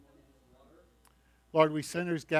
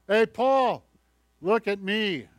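loud, higher, showing-off shout across the room; boastful call out; 6 semitones higher, 7 dB louder, slower than usual. Retold or imitated. A show-off singer calls to Paul, loud and higher. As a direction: call out to be noticed, proud of yourself.

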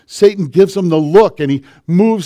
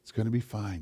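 very soft, soothing voice; reassuring mom; 5 semitones lower, 18 dB quieter, faster than usual. Retold or imitated. Mom telling her child it will be all right, softly reassuring. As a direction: comfort a child quietly and unhurriedly. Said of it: "when Mom would say".

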